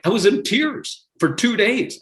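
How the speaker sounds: ordinary delivery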